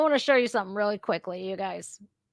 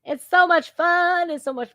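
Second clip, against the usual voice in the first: mock gushing; 9 semitones higher than usual. Mocking gushing repeat.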